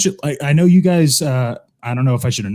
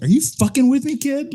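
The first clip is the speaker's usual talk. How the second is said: high-pitched incredulous movie quote; mock indignant; 12 semitones higher than usual. Santa challenging a little kid, mock indignant. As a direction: imitate exasperation with a kid.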